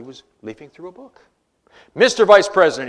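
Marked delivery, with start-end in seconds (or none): 1.9-2.9 loud, indignant senator demanding attention; angry senator. A narrator's reading. Offended senator confronting the vice president, loud and indignant. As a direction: storm up and confront the chair. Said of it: "The offended senator stormed from his seat".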